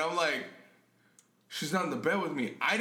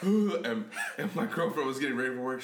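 usual speaking voice

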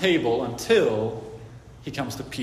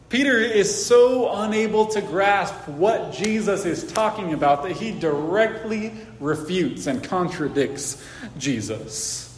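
ordinary talk

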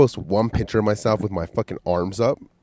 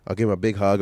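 ordinary talk, nothing marked

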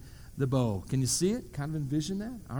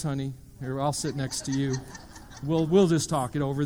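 normal talking voice

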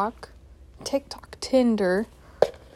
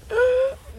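sharp startled gasp; 14 semitones higher than usual. Real, not acted. Lamp suddenly fails.

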